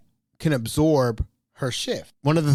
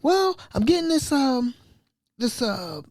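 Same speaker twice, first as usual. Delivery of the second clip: high-pitched mock sick caller; playing it cool; 11 semitones higher than usual. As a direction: imitate her casual excuse, high and halting. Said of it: "she's playing like it's cool".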